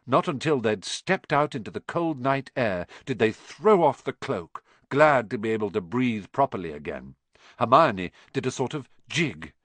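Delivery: ordinary delivery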